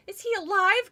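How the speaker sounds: put-on frightened girl demanding an answer; breathless. A narrator's reading. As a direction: gasp it out in panic. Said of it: "Chris repeated breathlessly".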